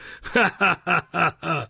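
self-satisfied laugh of a beadle; pompous chuckle. Acted. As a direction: laugh smugly at his own joke. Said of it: "had laughed a little while".

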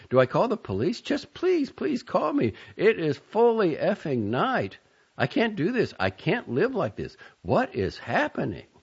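high, desperate pleading; panicked. A narrator's reading. Panicked texts.

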